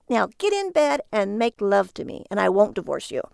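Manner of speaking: an order, half threat; demanding wife. Acted.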